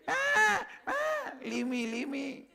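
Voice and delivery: put-on high, pleading bleat of a young goat; bleating cub. Captured cub bleating to the lion, high pleading bleat. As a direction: bleat it out like a frightened kid goat. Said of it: "started bleating same way".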